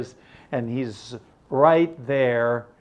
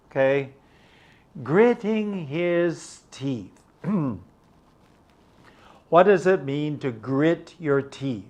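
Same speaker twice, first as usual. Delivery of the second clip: ordinary talk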